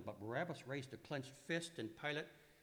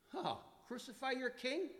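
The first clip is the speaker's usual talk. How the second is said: retort; 12 semitones higher than usual. Acted. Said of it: "Pilate retorted".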